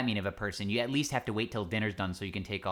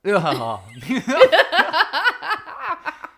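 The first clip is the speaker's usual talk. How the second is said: loud, high cackling laugh; big burst of laughter; 12 dB louder than usual. Real, not acted. Co-host hears the short guy's name, bursts out laughing. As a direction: crack up explosively, long and loud.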